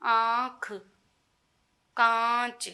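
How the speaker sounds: आँख and कांच with the vowel through nose and mouth; nasal vowels. Shown as a demonstration. Teacher demonstrating nasalised vowels to students. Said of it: "इनके ऊपर चंद्र बिंदु लगाया जाता है".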